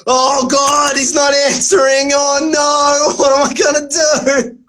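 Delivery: panicky mock host. Mocking the host's panic as he waits, a hint of fretting. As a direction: play the flustered host as a joke. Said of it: "I've got you being like".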